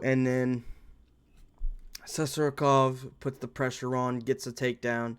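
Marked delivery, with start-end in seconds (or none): none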